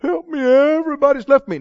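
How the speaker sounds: high, teary mock whimper; mock whining. Preacher mocks a believer whining that everyone left them. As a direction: parody a pitiful crybaby. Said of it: "start telling lies and go".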